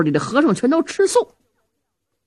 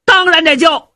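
loud retort; 6 semitones higher, 6 dB louder than usual. Acted. Zhao Hu snaps at servant.

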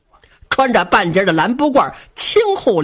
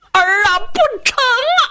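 desperate cry; 13 semitones higher than usual. Acted. Father begging his son not to sell the land, crying out. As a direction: plead with everything, a breaking cry.

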